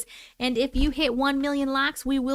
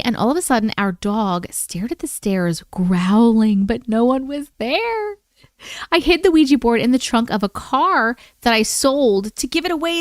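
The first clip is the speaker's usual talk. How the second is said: louder, steady storytelling read; story narration; 5 dB louder than usual. Acted. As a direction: read it like a campfire tale. Reads listener's scary story.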